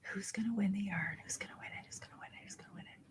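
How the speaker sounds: fading suspense whisper. Real, not acted. Suspense before winner.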